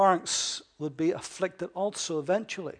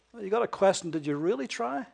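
ordinary talk, nothing marked

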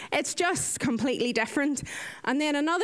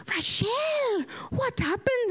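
much higher worried question; high startled concern; alarmed; 11 semitones higher than usual. Retold or imitated. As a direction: gasp out concern. Colleagues alarmed at bruised arm.

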